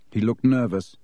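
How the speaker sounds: ordinary delivery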